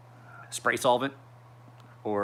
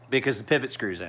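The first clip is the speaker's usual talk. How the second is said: usual speaking voice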